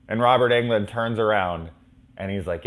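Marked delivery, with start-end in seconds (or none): none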